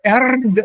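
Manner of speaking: wrongly spelled-out Erd; R pronounced. Shown as a demonstration. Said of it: "is not pronounced".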